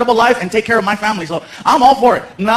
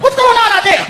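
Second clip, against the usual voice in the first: much higher, shouted from the back room; coworker calling out; 12 semitones higher than usual. Retold or imitated. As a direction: call from another room, curious.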